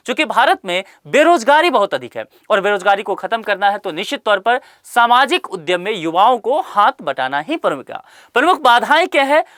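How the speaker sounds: ordinary talk, nothing marked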